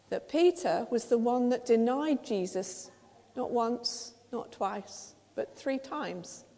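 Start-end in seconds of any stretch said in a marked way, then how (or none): 3.3-6.4 soft and sombre, three dragged slowly; hushed count. Shown as a demonstration. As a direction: drop to a near hush for the denial. Same count, now about Peter's denial.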